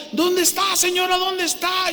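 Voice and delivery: put-on high urgent call for the old woman; calling out through the flames to find her; retold shout in the fire. Man shouting into a burning house for his neighbour. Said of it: "yo gritaba".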